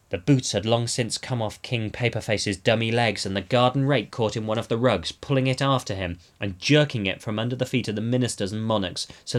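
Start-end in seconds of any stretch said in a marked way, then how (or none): none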